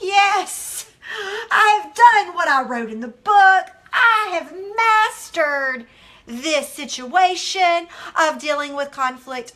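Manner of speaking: loud, high crowing victory; hammed-up self-congratulation; mock triumph. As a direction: proclaim it grandly, stretching the big words. Acting out her past triumph.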